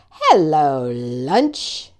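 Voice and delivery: low, sinister snake voice drawled out; a hungry snake eyeing its lunch; low menacing serpent; sly snake. Acted. Snake greets frog and toad.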